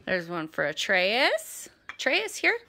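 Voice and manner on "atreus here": squeaky praising a dog. Real, not acted. Calling dog.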